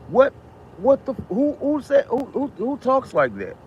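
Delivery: high, slow disbelief, stumbling over who; can't believe anyone talks like that; incredulous. Real, not acted. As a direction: pitch jumps high in stunned disbelief. Incredulous at the president's words.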